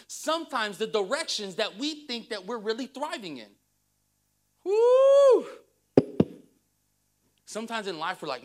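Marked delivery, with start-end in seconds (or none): 4.6-5.5 high, loud, sustained "woo"; loud whoop. Real, not acted. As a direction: let out a piercing preacher's whoop. Preacher whoops after hard point.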